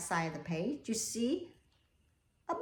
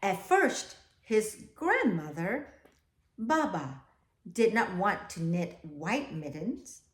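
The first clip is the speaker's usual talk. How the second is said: put-on slow, even read-aloud to children; storybook reading; 4 dB louder, slower than usual. A narrator's reading. Teacher reading the class a picture book, slow storytelling.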